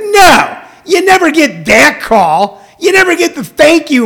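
high, drawn-out protest to daughter; emphatic retort. Retold or imitated. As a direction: cry out a big, exasperated no. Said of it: "And I said".

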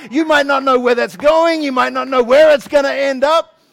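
very loud, high sermon delivery; full-force preaching. Real, not acted. Preacher to those unsure of the way, full-force preaching. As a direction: build to a preaching peak.

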